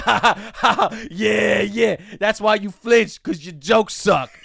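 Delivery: Knife Guy yelling at the comic who flinched; mocking shout. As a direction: laugh in his face and yell the insult. Retelling Knife Guy's mocking laugh.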